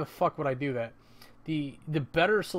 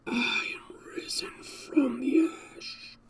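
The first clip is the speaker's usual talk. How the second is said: slow, higher whispered rasp; raspy villain whisper; 10 semitones higher, slower than usual. Shown as a demonstration. Voicing his game character Jack, slow rasping whisper. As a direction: breathe it out like a risen ghoul. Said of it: "I can do a voice like that or something for Jack".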